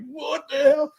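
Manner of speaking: high startled yelp, imitating a big guy spooked; retelling a friend's fright with a high squeak; freaked-out yelp. Imitating spooked Anthony in the basement, high startled yelp. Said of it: "you see Anthony freak out".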